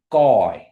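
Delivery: "guy" said loud with the G at the back of the throat; "guy" pronunciation demo. Shown as a demonstration. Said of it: "and you're saying".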